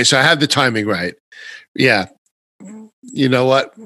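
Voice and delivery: usual speaking voice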